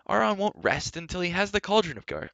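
high, urgent protest; impassioned young hero; crying out. A narrator's reading. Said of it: "Terran cried".

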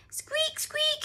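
very high, tiny squeaks; little rabbit squeak. A narrator's reading. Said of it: "said Richard".

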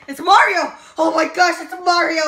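loud starstruck exclamation; excited fan. Acted.

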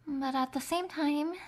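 normal talking voice

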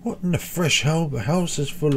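high, startled question; shocked disbelief. Real, not acted. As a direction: blurt it out in alarm. Waking up underwater.

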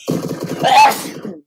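sharp sudden cough. Real, not acted. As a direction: cough into the mic. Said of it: "Ugh, excuse me".

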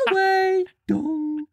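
very high, slow, sing-song Tinker Bell with voice breaks; a tiny fairy squealing in singsong outrage; sky-high, sung and cracking fairy squeal; squeaky fairy voice. Acted. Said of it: "I do enjoy the voice breaks".

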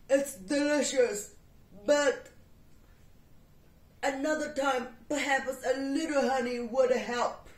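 loud bear voice; big bear asking for honey. Acted. Bear suggesting honey to the cat.

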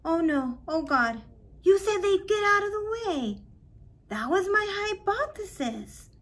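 high, loud panic; words tumbling out in alarm; panicked. A narrator's reading. Boy panics at Lonnie after hitting the pigeon, loud and high.

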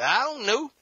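high, whiny I don't know; mock sullen reply. Preacher mocking a sulky spouse, high and whiny. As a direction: mimic a pouting spouse who won't answer.

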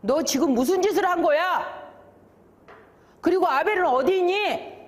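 high-pitched acted reproach; stern questioning. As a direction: confront the culprit and demand answers.

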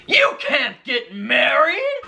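high, slow, shouted objection; scary villain voice. Acted. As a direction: drag out the words as a scary stink bug. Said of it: "the very scary voice was coming from".

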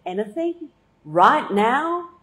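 high, loud mock surprise; mock eager. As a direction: feign delight, pitched high.

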